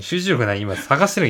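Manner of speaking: ordinary delivery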